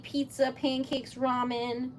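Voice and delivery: ordinary talk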